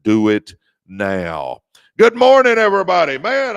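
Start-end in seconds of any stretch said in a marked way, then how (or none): 2.0-3.5 big, high, loud good morning to the crowd; excited loud greeting. Real, not acted. Host greeting his livestream viewers with a loud excited welcome. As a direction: boom it out like a morning show host.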